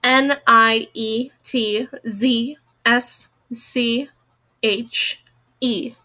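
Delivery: slow, letter by letter; spelling out. Real, not acted. Reader who can't pronounce a name, spelling out. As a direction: spell it slowly, pausing between letters. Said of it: "so I'm gonna spell it".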